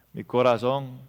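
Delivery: ordinary talk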